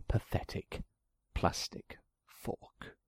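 slow, scornful whisper. A narrator's reading. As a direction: breathe it out in disgust. Story ends in hushed disgust.

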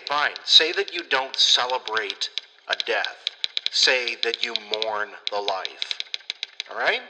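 ordinary talk, nothing marked

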